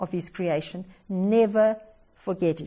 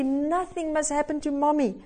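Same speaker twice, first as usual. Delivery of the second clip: usual speaking voice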